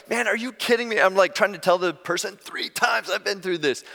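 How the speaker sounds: high-pitched, exasperated mock rant; incredulous retold complaint, high but not shouted; retold fury. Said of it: "I'm just, I'm furious".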